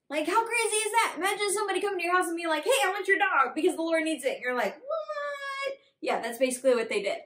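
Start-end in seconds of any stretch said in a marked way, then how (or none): 2.6-4.3 lightly acted demand, raised and quick; a bit louder, blurted comic request; brash stranger. Imagined stranger asks for your dog, mildly brash and quick. 4.8-5.8 very high, drawn-out incredulous squeak; mock disbelief. Imagined homeowner hears absurd request, mock high-pitched disbelief. Imagined baffled reaction to stranger.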